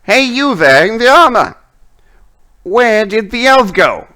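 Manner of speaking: high, loud, demanding witch; Lilith. Acted. Witch interrogating the one in armor about the elf, high angry shout. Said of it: "you can hear once again Lilith's voice".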